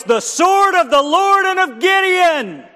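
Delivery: 高声呐喊，声音拔高很多; 像举着火把冲锋那样喊出来; 战斗呐喊. Acted. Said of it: "And then he yells".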